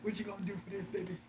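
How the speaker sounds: low murmured leer; creepy man voice. Retold or imitated. Mocking a creep asking what's in it for him.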